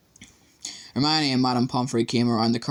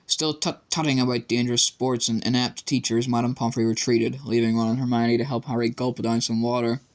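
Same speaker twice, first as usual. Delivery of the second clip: plain reading voice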